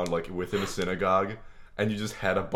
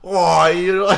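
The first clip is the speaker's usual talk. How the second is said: loud, high, badly faked Yiddish accent; clumsy mock Yiddish accent; attempted Yiddish accent; 9 semitones higher, 10 dB louder, slower than usual. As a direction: have a go at a hammy Yiddish accent. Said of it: "talking in, like, a Yiddish accent".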